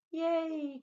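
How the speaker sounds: loud, high joyful yay; cheering cry. A narrator's reading. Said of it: "cried the sheep".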